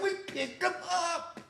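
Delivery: slow, very high squeal; mock-pleading insistence. Teen insisting to police he found them, pleading. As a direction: stretch it out as a squeaky protest.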